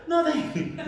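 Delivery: high, timid acted reply; meek. Employee answering his angry boss, high and meek. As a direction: squeak it out as a cowed underling.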